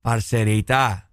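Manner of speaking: slow, low Colombian lilt; lingering, low-pitched Colombian imitation; Colombian accent. Shown as a demonstration. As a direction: model a drawn-out Colombian accent. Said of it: "hacelo vos y yo lo repito".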